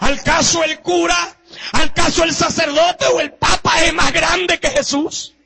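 preacher shouting about Jesus to the congregation; preaching at full force. Acted. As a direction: thunder it from the pulpit. Rhetorical challenge.

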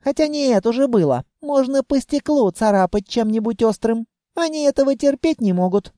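put-on high-pitched little girl musing; dreamy child. A narrator's reading. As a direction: plot aloud like a child daydreaming. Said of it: "мечтательно протянула она". (мечтательно, высоким детским голосом)

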